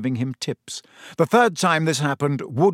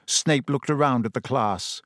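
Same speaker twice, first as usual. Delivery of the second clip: ordinary delivery, nothing marked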